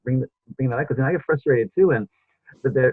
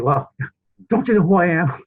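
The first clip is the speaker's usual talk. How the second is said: mock self-important, pitched up, half laughing; mock haughty; 5 semitones higher than usual. Host mocking his own urge to pull rank, laughing. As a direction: play the pompous big shot for a laugh. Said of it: "I wanna either raise my voice".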